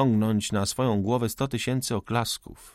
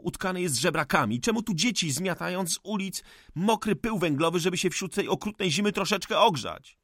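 raised high, passionate accusation; heated outburst; 7 semitones higher than usual. Acted. Cezary rails at the old forester, high and furious. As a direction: hurl it at him like an indictment. Young man's furious tirade.

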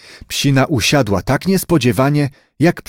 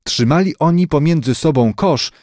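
ordinary delivery, nothing marked

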